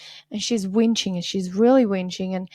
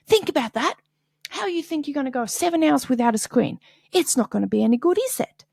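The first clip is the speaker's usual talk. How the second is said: scolding mom; 6 semitones higher, 4 dB louder, faster than usual. Retold or imitated. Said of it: "So she's like".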